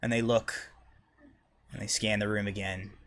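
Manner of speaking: normal talking voice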